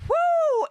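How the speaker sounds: loud high whoop; excited whoop. Real, not acted. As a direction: whoop with glee. Cheering Meghan's unpopularity.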